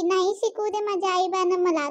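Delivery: usual speaking voice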